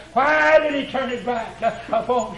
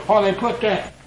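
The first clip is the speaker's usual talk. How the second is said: usual speaking voice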